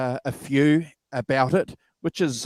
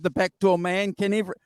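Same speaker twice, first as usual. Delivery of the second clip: ordinary delivery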